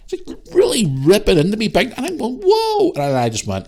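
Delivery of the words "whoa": one very high exclamation; shocked whoa. Retold or imitated. Shopper being ripped into, shocked protest. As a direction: jump up in pitch, taken aback.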